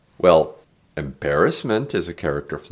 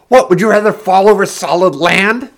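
high, loud, desperate scream; shouting back; 11 semitones higher, 8 dB louder than usual. A narrator's reading. Heron reveals she can't swim, shouting desperately. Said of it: "she shouted back".

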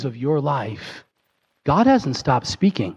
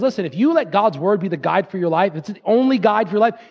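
higher, pleading sermon climax; impassioned preaching; 8 semitones higher than usual. Acted. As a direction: lift them toward surrender.

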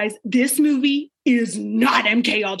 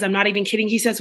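normal talking voice

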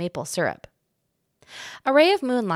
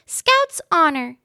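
high, slow solemn little promise; mouse voice; 9 semitones higher, 6 dB louder, slower than usual. A narrator's reading. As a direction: swear it like a proud little mouse.

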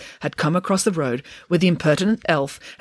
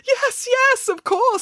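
very high, panicky assent; flustered, squeaky manager; frantic agreement; much slower than usual. A narrator's reading. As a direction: agree desperately, pitch shot right up.

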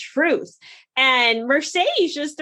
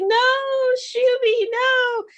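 much higher, pleading mock wail; melodramatic anime rival; 9 semitones higher than usual. [name] begs Shuby to stop defending Joey, anime melodrama. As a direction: overwrought anime protest. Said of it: "You guys are an anime right now".

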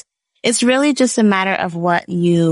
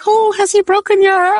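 much higher, teasing sympathy in a changed voice; sympathetic French teacher; 4 dB louder than usual. Retold or imitated. French teacher assuming a boy broke her heart, high sympathetic voice. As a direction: play the teacher sure it's about a boy. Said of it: "He's like".

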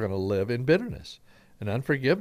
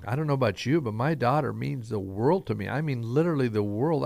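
ordinary delivery

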